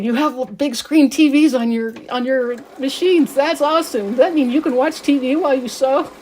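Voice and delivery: son-in-law amazed by the sewing machine screens, excited and loud. Retold or imitated. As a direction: play the thrilled son-in-law who just found out. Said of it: "he was just all excited".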